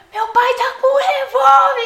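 high, loud shouted warning; frantic yell. Retold or imitated. Running after her father, she yells a warning, loud and high. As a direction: scream it down the street.

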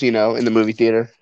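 ordinary talk

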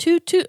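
playful high-pitched toot-toot; train toot. Pretend. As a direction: toot like a little train whistle. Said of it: "that's a train".

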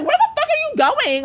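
high-pitched indignant call; indignant challenge. Retold or imitated. Calling after the woman walking off, acted indignation.

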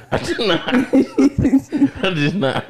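high, laughing "I did not"; laughing denial. Real, not acted. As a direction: protest while laughing. Denying the offensive remark amid laughter.

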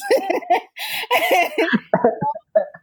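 a burst of high laughter. Real, not acted. Mother recalling her son's reaction, laughing high.

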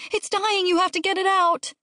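very high, urgent plea; high-pitched childish alarm; frantic child. A narrator's reading. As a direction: beg Mom like a panicking kid. Child begs mother for rescue.

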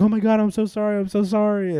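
high, fluttery, gushing apology; flustered apologetic lady. Retold or imitated. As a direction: play the mortified lady saying sorry over and over. Lady apologizing after bag falls.

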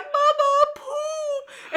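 high, whimpering toddler voice, imitated; crying little boy. Mom imitating her son in the other room, tearful. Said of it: "He's crying".

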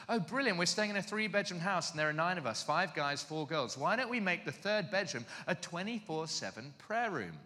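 high-pitched, gushing enthusiasm; intense keen types. Retold or imitated. Intense Christians propose a prayer room to housemates, over-keen. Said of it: "these two rather intense types".

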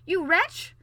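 high, loud royal threat; raging, full-voiced outburst; furious shout. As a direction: explode at an insolent underling. King threatens the defiant prince.

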